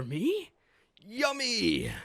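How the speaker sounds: high, loud squeal of delight; loud, high happy squeal. Acted. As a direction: gush over the food. Jenny eating, voiced.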